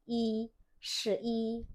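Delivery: shi i said slowly and clearly, shi then i; teacher slowing down to model eleven; slow Mandarin model. Shown as a demonstration. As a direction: stretch out shi i so students hear both syllables.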